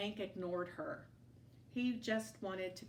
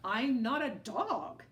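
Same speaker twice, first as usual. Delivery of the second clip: coyote voice; 3 semitones higher, 6 dB louder than usual. A narrator's reading. Coyote voice in story.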